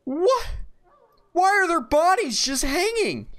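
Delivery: high, loud alarmed questions; shocked disbelief. Real, not acted. Gamer horrified at bodies hanging in the woods. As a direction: recoil in horror at what you see.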